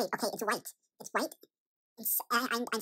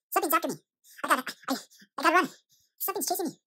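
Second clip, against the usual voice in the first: panicked; 3 semitones higher, 7 dB louder, faster than usual. Acted. Being chased in the dream.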